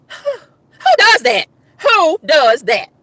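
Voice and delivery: very slow, drawn-out disbelief; appalled. Real, not acted. Woman venting to viewers, slow appalled question. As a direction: drag out each word, aghast.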